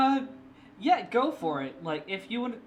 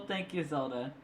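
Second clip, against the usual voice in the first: ordinary talk, nothing marked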